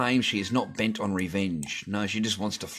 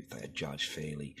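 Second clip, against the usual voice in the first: softer, mocking mutter of the quote; 5 semitones lower, 11 dB quieter than usual. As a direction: sneer it back under your breath. Mocks the quote after swearing.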